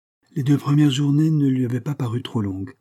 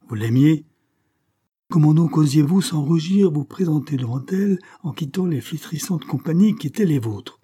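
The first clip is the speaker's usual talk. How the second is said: louder, higher reproach; indignant accusation; 6 semitones higher, 5 dB louder than usual. A narrator's reading. Father shaming his son over debauchery, indignant. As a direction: accuse him with raised voice.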